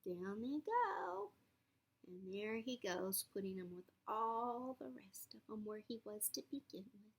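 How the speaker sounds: ordinary delivery, nothing marked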